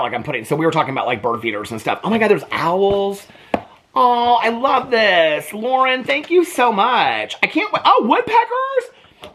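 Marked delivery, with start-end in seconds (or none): 2.0-9.3 high, gushing squeals of delight, slowing on the gasps; real thrill spilling over at each picture; squealing over owls and woodpeckers in a gift book; excited squeal. Real, not acted.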